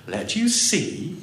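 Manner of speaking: slow, doubtful question; doubtfully. A narrator's reading. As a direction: drag out the doubt. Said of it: "looked at them doubtfully".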